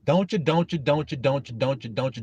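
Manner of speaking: looped repeats, sinking in pitch; skipping record. Acted. Mocking the nagging doubter, skipping record. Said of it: "It's skipping".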